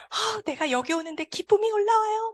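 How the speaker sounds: high-pitched imitation of an uplifted sponsor; joyful sponsor. Visiting sponsor tells the cancer patient joy is welling up, high and elated. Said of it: "스폰서님 뭐라고 말씀을 하셨냐면".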